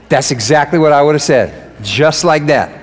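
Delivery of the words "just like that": usual speaking voice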